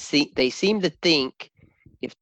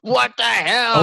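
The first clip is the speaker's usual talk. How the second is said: high, loud, drawn-out disbelief; scandalized and loud, playing along; mock shock; 9 semitones higher, 5 dB louder, slower than usual. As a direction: stretch it out in comic outrage.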